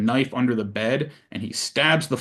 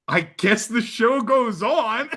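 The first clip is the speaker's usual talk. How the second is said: slow, higher, through a laugh; higher slow chuckling resignation; wry laughing director; 10 semitones higher, slower than usual. Retold or imitated. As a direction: shrug it off with a nervous laugh. Director Rob voiced.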